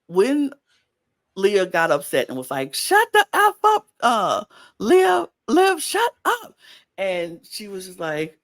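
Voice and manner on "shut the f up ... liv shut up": high, loud mimicked yell at a castmate; mock yell. Podcaster imitating Leah yelling shut up, high mock yell. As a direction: squeal it out as Leah losing it.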